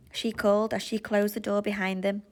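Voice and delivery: plain reading voice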